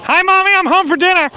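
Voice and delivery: put-on high little kid voice. Jokey line while riding.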